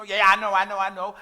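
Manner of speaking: loud, impatient mimicry of brushing someone off; mock dismissive. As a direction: imitate someone cutting off advice.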